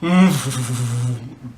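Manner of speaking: engine rumble made with the mouth; sputtering vocal motor noise; imitated engine start. Storyteller starts the car, imitated engine.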